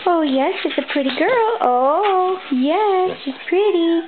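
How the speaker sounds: high-pitched pet talk; gushing, high praise for a pet; cooing praise. Real, not acted. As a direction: coo over a beloved dog.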